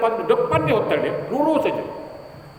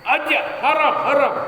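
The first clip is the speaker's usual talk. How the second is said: driver calling; 8 semitones higher, slower than usual. Retold or imitated. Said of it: "Makcik tu tak naik-naik".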